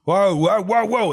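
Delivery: high, very loud protest; affronted whoas. Acted. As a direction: halt the insult with loud whoas. Gang boss reacts to an insult.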